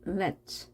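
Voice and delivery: "let" closed with a single ts sound, like lets; merged ts ending. Shown as a demonstration. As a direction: finish "let" on a fused ts. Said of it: "츠 발음이 되겠죠". ("let" 끝을 렛츠처럼 츠로)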